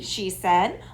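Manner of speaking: usual speaking voice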